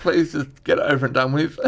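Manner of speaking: high, resigned plea; mock pleading. Comic bracing for a fight with the brothers, high mock plea. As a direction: beg them to just get it over with.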